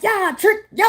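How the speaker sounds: high, loud mocking yell; guard-up shout. Answering a returning lover with guard up, loud shout. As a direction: yell it high, warding someone off. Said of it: "get your motherfucking guard up".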